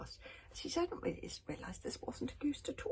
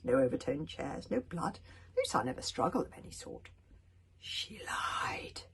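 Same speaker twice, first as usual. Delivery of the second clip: ordinary delivery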